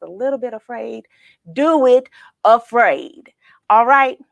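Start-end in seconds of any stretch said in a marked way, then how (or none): 1.5-3.3 slow, loud, deliberate; emphatic encouragement. Real, not acted. Host urging listeners to face fear, slow and emphatic. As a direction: press each word home like a motto.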